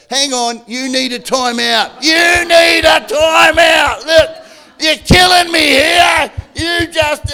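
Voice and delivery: mock angry yell at the spouse, played for laughs; hammed-up shouting at spouse. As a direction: bark it at your spouse in mock fury. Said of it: "Don't call time out for your spouse".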